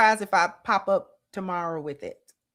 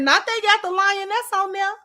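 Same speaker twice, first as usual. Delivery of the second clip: high-pitched gleeful disbelief; high, giddy retelling; delighted reaction; 10 semitones higher than usual. Retold or imitated. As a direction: exclaim it way up high, tickled to see her. Acting out own delighted reaction.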